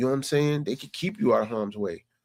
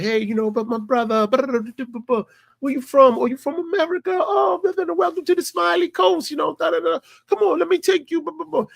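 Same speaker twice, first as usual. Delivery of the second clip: high, eager street hustler sales patter; hustler's smooth pitch; 14 semitones higher, 3 dB louder than usual. Retold or imitated. Hustler greeting an American tourist, high eager sales voice. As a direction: sweet-talk the tourist like a slick hustler. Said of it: "He's like".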